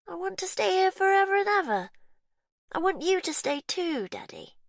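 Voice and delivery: put-on sweet but unsettling child; eerie little girl. A narrator's reading. Faith begging daddy to stay, eerie high child voice.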